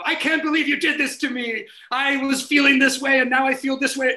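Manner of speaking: overdone soap-star drama; soap opera melodrama. Acted. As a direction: play it like daytime TV. Parody soap dialogue.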